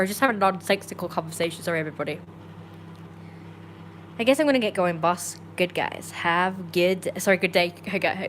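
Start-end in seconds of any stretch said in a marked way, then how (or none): none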